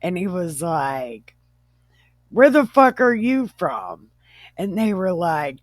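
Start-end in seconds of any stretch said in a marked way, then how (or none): 2.3-4.1 louder, higher, rude demand; talking like a dick. Acted. Joe questioning his unrecognized brothers, like a dick. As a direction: challenge them like an obnoxious jerk. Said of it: "he would talk like a dick".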